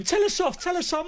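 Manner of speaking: high-pitched mimicked kids; pestering kids. Retold kids pestering him while filming.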